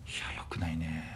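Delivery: sheepish whispered admission. Real, not acted. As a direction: drop to a voiceless whisper. Whispers agreement after being scolded.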